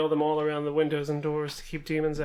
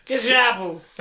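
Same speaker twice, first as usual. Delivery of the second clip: sales yell; 5 semitones higher, 5 dB louder than usual. Acted. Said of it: "What are you yelling?".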